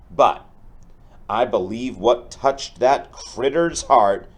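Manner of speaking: building up the stampede, bright and energetic; lively storybook read-aloud. Acted. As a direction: make the rescue exciting for the kids. Teacher reads picture book aloud.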